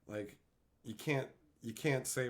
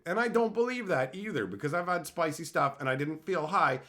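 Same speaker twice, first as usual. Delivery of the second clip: usual speaking voice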